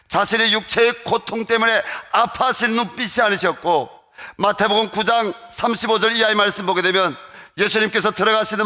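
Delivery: loud, high, impassioned preaching voice; full-force preaching. Acted. Preacher telling the congregation of Jesus' compassion, loud and high. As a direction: proclaim it to the whole congregation, pitch rising.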